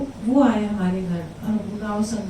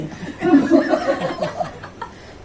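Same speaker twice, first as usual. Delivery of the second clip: laugh; 6 semitones higher than usual. Real, not acted. Laughing at her own reply.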